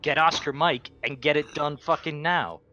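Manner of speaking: usual speaking voice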